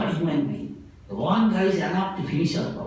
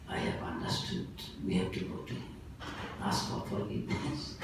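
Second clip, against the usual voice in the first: quiet; 7 semitones lower, 11 dB quieter than usual. Retold or imitated. Dacoit leader gives up.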